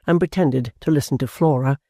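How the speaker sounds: ordinary delivery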